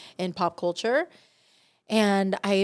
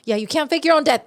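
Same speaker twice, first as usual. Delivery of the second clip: loud warning; 8 semitones higher, 8 dB louder, faster than usual. Real, not acted. Loud, fast comic warning.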